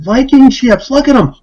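high, eager and thrilled; excited. Retold or imitated. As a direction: point them out like a boy seeing ships. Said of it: "He's getting all excited".

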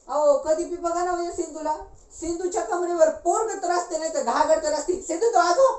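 high, agitated griping; indignant high-pitched rant; shrill complaint. Acted. Woman complains loudly about Sindhu.

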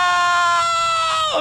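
high shrieking mock scream. Streamer reliving his stage intro, high shrieking scream. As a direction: scream like he's terrified. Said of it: "I'm like".

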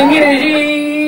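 ordinary talk, nothing marked

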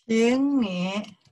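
very drawn-out syllables; slow word-by-word teaching demonstration; slow model phrase. Shown as a demonstration. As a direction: stretch each tone for learners to copy. Teacher models the sentence again.

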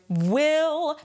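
"will" pushed out from closed lips. Shown as a demonstration. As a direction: start "will" from a closed mouth. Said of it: "pushing out the sound".

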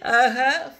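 high, loud burst of laughter. Real, not acted. Reader laughing at her own joke, loud. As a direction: crack up.

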